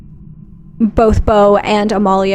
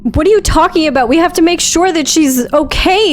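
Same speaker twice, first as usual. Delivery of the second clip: high, rapid, incredulous pleading; distressed protest; 8 semitones higher, faster than usual. Acted. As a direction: argue desperately to save a friend. Acted argument over leaving Gertie.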